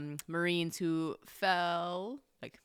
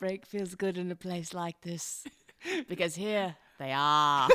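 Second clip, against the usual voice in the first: over-the-top Kidman movie-ad voice; overdone Nicole Kidman. Retold or imitated. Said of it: "go a little too hard into it".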